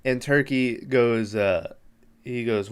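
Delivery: normal talking voice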